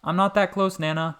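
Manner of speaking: high, loud protest; protesting call. A narrator's reading. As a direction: defend yourself, calling back. Boy protests loudly.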